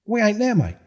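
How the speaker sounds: flat-out deny it, indignant; retold heated denial. Denying the accusation to the man, indignant.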